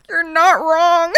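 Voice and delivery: high, slow, laughing through tears; laughing cry. Real, not acted. Streamer laughing tearfully at a chat comment. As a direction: let the laugh break the words.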